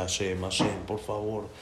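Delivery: soft, pleading prayer through tears; hushed tearful pleading; tearful quiet plea. A narrator's reading. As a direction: beg God softly, close to crying. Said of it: "Empieza a llorar y a pedir tefilá".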